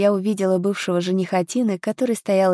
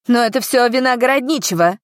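higher-pitched accusation from the crowd; mildly heated outburst; blurted out; 5 semitones higher, 4 dB louder than usual. A narrator's reading. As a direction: throw the blame out suddenly. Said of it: "выпалил кто-то из толпы".

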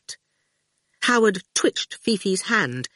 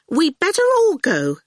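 much higher and anxious; lightly voiced nervous sister; nervous young woman; 11 semitones higher, 3 dB louder than usual. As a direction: try to sound brave but scared. Sister Fifi voiced in story.

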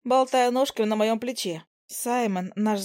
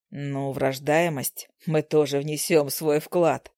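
smug low husband; 6 semitones lower, slower than usual. Acted. Smug husband teases wife.

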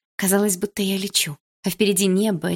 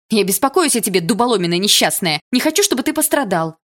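louder, higher cry of worry; upset shout; 3 semitones higher, 6 dB louder than usual. A narrator's reading. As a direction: yell that you care, near tears. She yells her worry at him.

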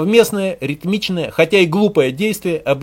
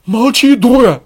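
high, loud scared outburst; alarmed male yell; frightened man; 7 semitones higher, 10 dB louder, slower than usual. A narrator's reading. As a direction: shout her down in fright. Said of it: "Испуганный мужской".

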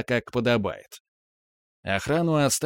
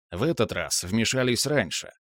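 ordinary delivery, nothing marked

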